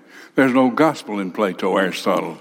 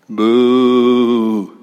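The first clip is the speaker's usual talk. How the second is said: mock crowd boo; 4 dB louder than usual. Crowd jeers Paul.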